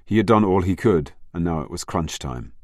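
plain reading voice

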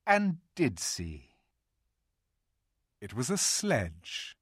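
plain reading voice

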